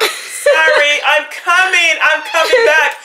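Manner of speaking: high, loud, playful calling out; playful shout. Real, not acted. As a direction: call out an apology cheerfully. Apologizing loudly to viewers.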